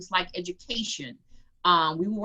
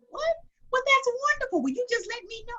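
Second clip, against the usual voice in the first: surprised mentor; faster than usual. Retold or imitated. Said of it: "And he goes".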